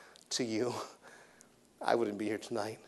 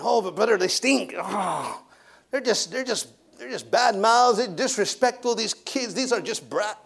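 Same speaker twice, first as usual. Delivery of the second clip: higher, whiny complaining church member; mock complainer; 8 semitones higher, 4 dB louder than usual. Church member grumbling to the preacher about the kids, higher and whiny. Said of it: "voicing complaining churchgoers".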